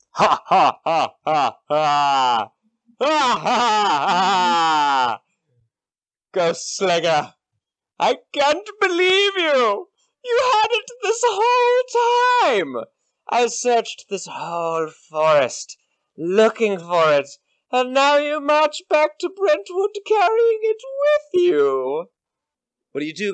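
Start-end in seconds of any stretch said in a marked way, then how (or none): 0.1-5.2 slow booming villain laugh; evil cackle. Acted. Villain laughs at night camp. 6.3-22.1 much higher, slow, crazed villain voice; unhinged villain. Acted. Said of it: "You hear laughter".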